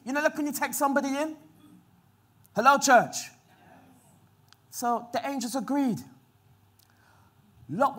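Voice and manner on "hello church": ordinary talk, nothing marked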